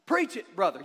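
mock amen corner. Mock congregation response.

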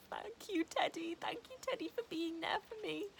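high, loud mock-tearful gratitude to the dog; tearful thanks to dog. Retelling crying thanks to Teddy, high and wobbly. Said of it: "So I was like".

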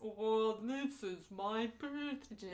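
slow, dragged-out toy voice winding down; talking doll running out of power; doll with dying battery. Acted. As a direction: drag the words out as the battery dies. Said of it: "battery starts dying".

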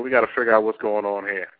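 ordinary talk, nothing marked

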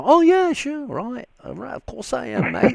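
high, hammed-up English accent; jokey put-on English accent to fool locals. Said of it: "start talking with an English accent".

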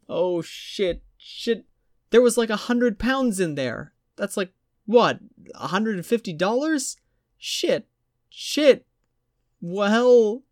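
high, frantic swearing; panicking woman. A narrator's reading. Jennifer panicking over her lost wallet, high and frantic.

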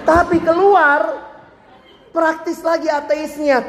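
mock punchline.

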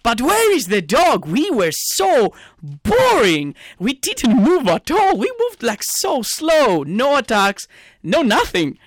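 high, drawn-out exasperated complaint; exasperated rant. Real, not acted. Fan fed up with a boring team, ranting. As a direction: stretch the key words as your pitch soars. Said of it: "the thing that I wanna shout about".

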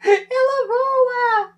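crying out in real fright; high, slow, tearful shriek; panicked wail. Real, not acted. Cockroach flies, panic.

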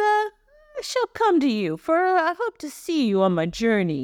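hammed-up American Italian, starting high; American Italian accent. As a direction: read scripture like an Italian-American uncle. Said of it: "this is more American Italian".

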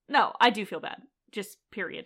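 ordinary talk, nothing marked

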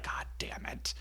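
a whispered oh-no curse. Retold or imitated. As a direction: hiss it under your breath. Liesel's oh-shit face.

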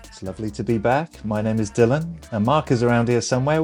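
ordinary talk, nothing marked

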